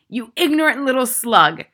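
high, loud insult; Trunchbull bellow. A narrator's reading. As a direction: bellow abuse at a pupil. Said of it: "the Trunchbull bellowed".

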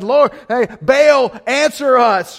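loud, high pleading call; frantic cry to Baal. Acted. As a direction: beg the idol to answer. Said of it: "and said".

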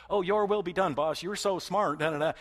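butter up the boss insincerely; hammed-up sucking up to the boss; mock flattery to a boss. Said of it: "the way we say it to our boss".

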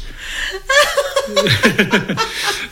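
high delighted laugh. Real, not acted. Retelling a film scene, laughing high.

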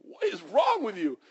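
high, loud startled scream, then an indignant question; panicked yelp. Retold or imitated. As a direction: flinch and shriek as the ball comes at you. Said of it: "and he's like".

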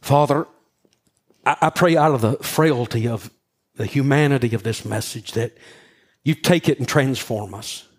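prayer. Acted. Closing prayer.

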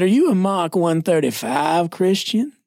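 mock-solemn Southeastern lilt; Southern pastor impression. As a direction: quiz a pupil in a stretched-out Southern accent. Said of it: "he talked like this".

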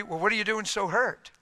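normal talking voice

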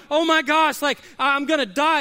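hammed-up alarm; playful fright at the doctor's news; mock panic. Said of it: "you go".

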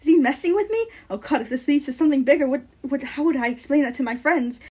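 buzzing thoughts. Acted. Narrator's thoughts racing after being called cute, flustered panic. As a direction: spiral through flustered questions. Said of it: "in my head, my thoughts are buzzing".

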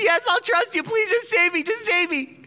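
high, loud frantic begging; desperate pleading. Acted. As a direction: plead to be saved, panicking. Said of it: "And Freddie says".

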